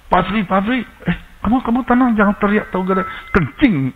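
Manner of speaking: high-pitched, pressing appeal; urgent retold call. Retold frantic call, urging calm.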